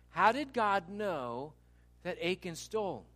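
normal talking voice

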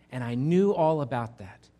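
ordinary talk, nothing marked

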